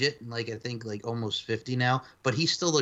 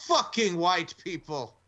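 quoting movie line; 5 semitones higher, slower than usual. Retold or imitated. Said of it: "and he goes".

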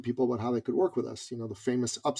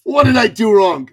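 loud, high-pitched panicked outburst, hammed up; mock panic; 10 semitones higher, 17 dB louder than usual. Man panicking in his head at tears, mock panic. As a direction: shout it as a comic inner alarm. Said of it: "I panic".

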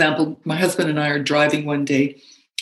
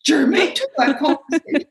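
mock British; 10 semitones higher than usual. Husband teasing her word choice, in a mock British accent. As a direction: parrot the word like a posh Brit. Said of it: "That's not germane".

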